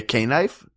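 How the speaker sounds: "knife" with the letter K said first, kay-knife; sounded K. Shown as a demonstration. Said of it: "had their things mispronounced".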